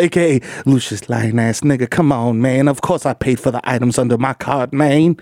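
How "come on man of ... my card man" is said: ordinary talk, nothing marked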